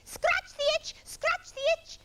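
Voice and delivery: very high parrot chant; Parrot screeching excitedly. A narrator's reading. Parrot urging Monkey on, excited screech. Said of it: "screeched Parrot excitedly".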